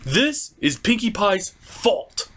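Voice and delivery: usual speaking voice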